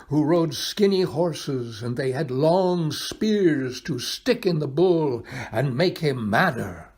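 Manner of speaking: ordinary delivery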